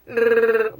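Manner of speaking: bear growl. Retold or imitated. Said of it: "normally when bears are like".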